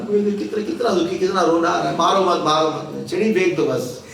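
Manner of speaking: hammed-up little-boy begging to be spared; fake tearful pleading. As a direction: plead like a child putting on tears. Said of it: "नाटक किया".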